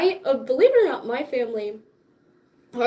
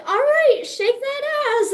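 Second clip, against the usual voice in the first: much higher, louder teacher voice; dance teacher; 11 semitones higher, 4 dB louder than usual. Retold or imitated. Dance teacher to her class, a high loud peppy command. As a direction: bark it like a peppy instructor. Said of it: "she was like".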